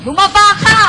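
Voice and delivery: high loud shouted order; shouted command. Acted. Ordering someone to drop.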